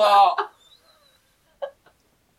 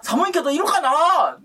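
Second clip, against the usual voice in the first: put-on kid voice; 9 semitones higher, 4 dB louder, slower than usual. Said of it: "みたいな".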